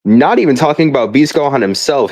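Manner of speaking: ordinary delivery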